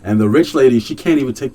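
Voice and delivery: loud, high exasperated rant. Real, not acted. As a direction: vent it loudly, outraged. Ranting at the rich lady.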